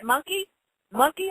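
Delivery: lightly imitated little one, questioning; small child's question.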